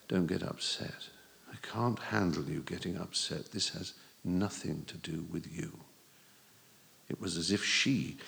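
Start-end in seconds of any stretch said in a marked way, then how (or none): 0.1-5.8 grey voice. Acted. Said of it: "her voice sounded grey too".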